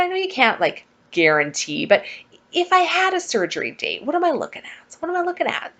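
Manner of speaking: butter up your new best friend; sweet pleading ask. Retold or imitated. Sweetly asking the booking girl.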